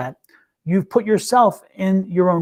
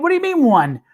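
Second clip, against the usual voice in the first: taken aback; 8 semitones higher than usual. Retold or imitated. Retelling her disbelief at his answer.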